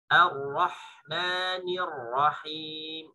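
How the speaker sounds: Ar-Rahmanir Rahim drawn out with a thin A; slow recitation. Shown as a demonstration. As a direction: recite slowly and precisely. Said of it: "Latih bunyi A-nya tipis".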